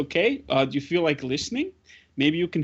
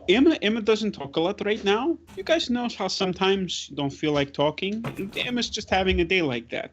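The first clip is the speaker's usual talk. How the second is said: higher, patient explaining voice; kindly adult to children; 7 semitones higher than usual. Acted. Grudek explains Emma's silence to Siobhan, patient. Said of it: "Grudek says".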